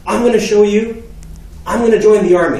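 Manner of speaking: high, loud declaration; retold shouted vow to dad; heated defiance. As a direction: announce it defiantly in anger. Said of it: "in the heat of the moment".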